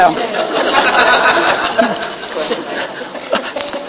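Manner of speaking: quiet breathy laugh; soft laugh. Real, not acted. Speaker laughs at her mind joke, soft laugh. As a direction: chuckle at your own joke.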